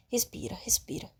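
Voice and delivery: soft unvoiced whisper to herself; whispered self-calming. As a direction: breathe the words out, barely audible. Narrator calming herself, panicking.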